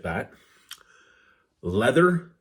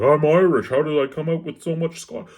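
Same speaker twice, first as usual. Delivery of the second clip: indignant father, pitched higher and louder; mock dad; 7 semitones higher, 5 dB louder than usual. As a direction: ham up the dad's disbelief on "I'm Irish". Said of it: "he's like".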